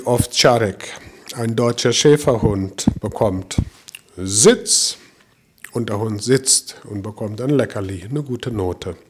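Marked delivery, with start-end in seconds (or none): 4.2-5.0 knapper, lauter Befehl; Hundekommando. Retold or imitated. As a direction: wie zum Hund befehlen. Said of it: "dann ist das ein Kommando". (scharfer Befehlston)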